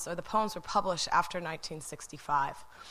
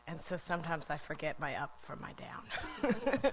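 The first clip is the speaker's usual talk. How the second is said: breathy laugh through the words; sheepish laugh while admitting confusion; laughing whisper; 7 dB quieter than usual. Real, not acted. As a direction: giggle it out under your breath. Laughs at own mix-up.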